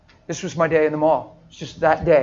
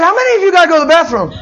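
calling out; 10 semitones higher, 8 dB louder, faster than usual. Retold or imitated. Preacher calls out to the crowd.

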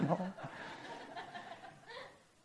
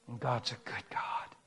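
slow whisper; 4 semitones lower, 12 dB quieter, slower than usual. Real, not acted. Preacher to congregation, reverent whisper. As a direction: breathe it out reverently.

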